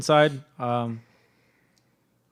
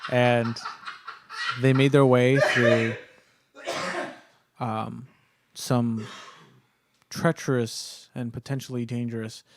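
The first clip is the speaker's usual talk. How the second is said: game master narration; 3 semitones lower, slower than usual. Acted. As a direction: set the scene for players.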